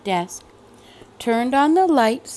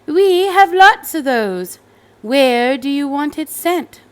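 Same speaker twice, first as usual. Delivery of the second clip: higher, louder, bright sales voice; cheerful shop lady; 4 semitones higher, 5 dB louder than usual. Acted. Store lady offering Cassandra a school, higher and louder. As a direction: sound eager to help. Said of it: "said the lady".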